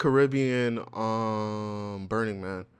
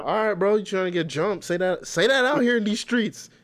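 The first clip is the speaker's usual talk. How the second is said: mock threatening; 7 semitones higher, 4 dB louder than usual.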